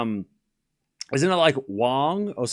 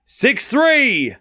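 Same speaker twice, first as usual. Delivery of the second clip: high, loud burst on the score; excited call; 13 semitones higher, 8 dB louder than usual. Real, not acted. Calling Anisimova winning the tiebreak, excited high shout. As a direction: shout the score as the set falls. Tiebreak set point won.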